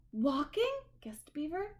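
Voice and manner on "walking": excited guess. Acted.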